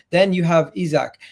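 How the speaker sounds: ordinary talk, nothing marked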